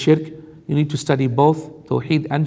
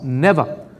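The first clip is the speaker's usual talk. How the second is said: ordinary delivery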